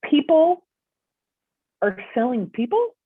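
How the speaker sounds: retelling the moment it sank in, voice climbing on people; shocked disbelief. Retold or imitated. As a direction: spell out the horror that people are sold. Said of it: "I said".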